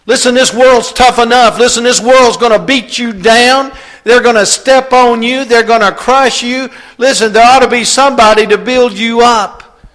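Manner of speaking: loud, high, urgent preaching; preacher pleading with fathers, loud and high; preaching at full force. Acted. Preacher urging dads to build their kids up, loud and high.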